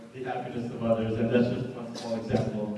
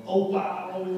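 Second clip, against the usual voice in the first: a higher-pitched mock "Oh wow!" as his friend always says it; Omar's trademark exclamation; 7 semitones higher than usual. Best man imitating his friend's usual reaction, lightly mocking. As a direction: lightly imitate a friend's signature surprised cry. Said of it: "lets out his traditional".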